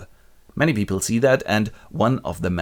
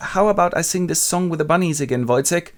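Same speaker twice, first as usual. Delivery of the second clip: fast, higher cheery coaxing; light sing-song offer; 8 semitones higher than usual. Retold or imitated. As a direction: humor a friend, fast. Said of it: "And Andres goes".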